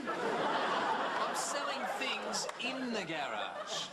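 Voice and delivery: very slow, drawn-out explanation; exasperated correction. Acted. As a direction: spell it out slowly for someone dim. Exasperated dad corrects her misunderstanding.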